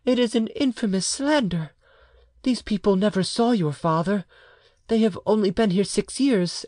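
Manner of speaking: high woman's voice, protesting; indignant mother. A narrator's reading. Mother denounces the slander on her marriage, indignantly.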